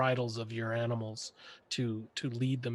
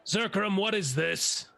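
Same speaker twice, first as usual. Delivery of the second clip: stern demand; 5 semitones higher than usual. Acted. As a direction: call him out by name, sharply. Officer confronting the militia leader.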